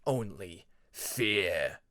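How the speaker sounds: very slow, low dark threat; menacing dragon. Acted. Shadow dragon circling Peacemaker, very slow and low. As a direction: drag each word out like doom.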